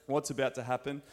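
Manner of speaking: ordinary talk, nothing marked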